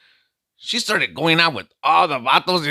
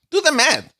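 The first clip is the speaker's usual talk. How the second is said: exasperated; 12 semitones higher, 4 dB louder than usual. Acted. Telling the audience to count.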